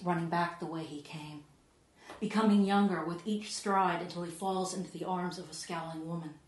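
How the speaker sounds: normal talking voice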